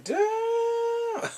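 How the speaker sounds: very high, drawn-out damn; shocked, impressed damn; stunned damn. Real, not acted. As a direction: gasp it out in disbelief. I'm like.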